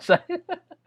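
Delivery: short high laugh; high amused laugh. Real, not acted. Vlogger laughing that he lives in North Carolina. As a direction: burst into a quick high laugh. Laughs at his own joke.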